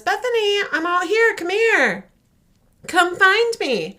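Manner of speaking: high, loud sing-song calling of a name; calling mother. Retold or imitated. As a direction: call out through the house like a mom. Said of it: "And she says".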